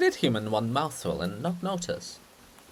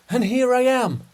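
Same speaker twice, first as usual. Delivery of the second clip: cheery arrival; 9 semitones higher, 6 dB louder than usual. Acted. Hrushevsky bursts in on Shevchenko.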